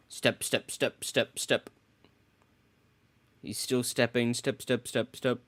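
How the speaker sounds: quiet step-step sounds; soft footsteps. Retold or imitated. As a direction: tiptoe the words softly. Imitating footsteps softly.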